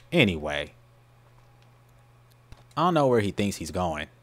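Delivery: usual speaking voice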